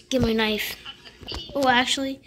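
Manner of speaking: normal talking voice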